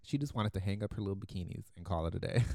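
ordinary talk

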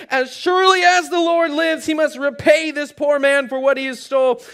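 high, heated, indignant shout; furious yelling. Acted. David demanding the poor man be repaid, yelling furiously. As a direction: yell the vow in outrage. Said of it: "He's yelling".

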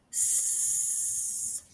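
soft unvoiced snake hiss. Kids matching animal sounds.

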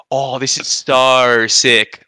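"so" drawn out slowly as sarr; mocked Aussie "so", stretched toward star; exaggerated Australian vowel. Imitating people who tease Australians, "so" exaggerated to sarr. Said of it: "people who were just saying, like".

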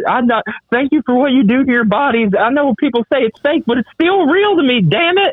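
high, hammed-up tearful plea; imitated crying fan. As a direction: choke up like an emotional fan at the mic. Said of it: "he starts crying".

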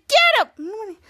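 high, loud cheer to attack; shouted urging. Retold or imitated. Girl urging on the fighters, shouted. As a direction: egg someone on in a fight.

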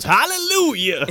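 high, drawn-out mock hallelujah; praying reverend. Reverend goaded to pray harder, high hammed-up praise. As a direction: wail it like a fired-up preacher. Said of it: "make him pray harder".

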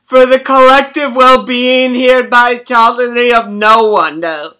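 hammed-up bellowing orator sliding into gibberish names; mock grand confession. Grandson teasing grandma with lies, hammed-up bellowed confession. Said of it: "You sound terrible. What kind of talk is that?".

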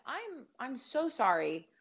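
slow, careful retold apology; hesitant apology. Fan retelling her approach to the actress, hesitant and careful. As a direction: ease into it slowly, feeling out each phrase.

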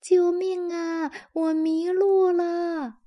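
high, slow, drawn-out cry for help; the lost teddy bear calling out for help. A narrator's reading. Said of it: "接着又叫".

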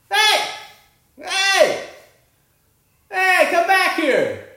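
high, loud shout from a distance; retold yelling. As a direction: yell to stop someone walking off. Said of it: "Who the fuck is yelling?".

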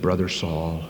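a hint of warm brotherly welcome; tender greeting. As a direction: greet him kindly like family. Ananias greets Saul.

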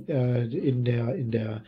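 losing the voice mid-sentence; voice giving out. Real, not acted. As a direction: voice fading away to nothing. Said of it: "Meine Stimme ist weg".

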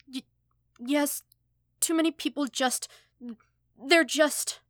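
high, loud tearful stammer; crying. Acted. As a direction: sob the apology out, stumbling over every word. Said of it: "hiccuping violently now, shaking from both his sobs".

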